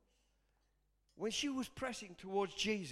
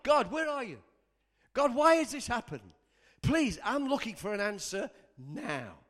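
retold angry plea, raised voice; shouting at God; 3 semitones higher, 6 dB louder than usual. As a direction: demand an answer from heaven. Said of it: "I actually shouted at God".

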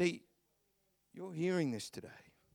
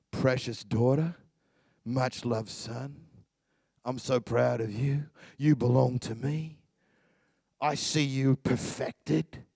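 tender voice of the Lord; 6 semitones lower than usual. Acted. Voicing the Lord's words.

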